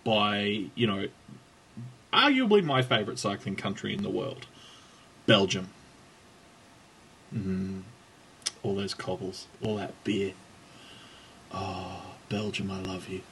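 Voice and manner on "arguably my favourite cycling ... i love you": low, soft reverie with long pauses; mock adoring. Host daydreams about his favourite cycling country, tenderly. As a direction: sigh lovingly over each thing, dropping lower and softer. Dreamy ode to Belgium.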